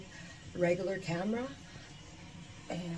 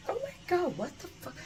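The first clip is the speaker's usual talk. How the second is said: high amazed gasp; 10 semitones higher than usual. Real, not acted. Amazed at the mirror, high. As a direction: gasp in disbelief.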